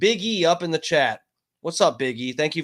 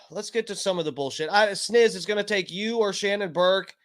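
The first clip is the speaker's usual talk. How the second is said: ordinary talk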